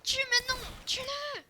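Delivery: high, tense in-character command; urgent kill order. Pretend. Captor ordering the prisoner killed, high urgent command.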